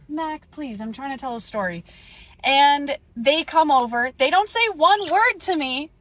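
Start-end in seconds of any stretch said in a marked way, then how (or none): 0.1-1.8 real soft aside, begging for patience; hushed interruption of your own story; soft pleading aside. Real, not acted. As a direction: drop the volume and gently plead. Real aside hushing Mac mid-story.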